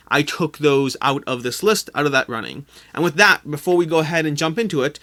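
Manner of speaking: ordinary talk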